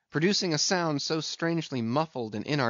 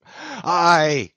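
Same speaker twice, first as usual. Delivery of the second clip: loud, higher anguished howl; shouted animal sob; 5 semitones higher, 10 dB louder than usual. A narrator's reading. Captain grieves his lost leg, shouting with an animal sob. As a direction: wail it out like a stricken beast. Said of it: "shouted with a terrific, loud, animal sob".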